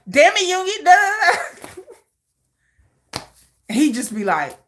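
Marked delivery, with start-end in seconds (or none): 0.1-1.8 mock angry yell. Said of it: "I'd be like".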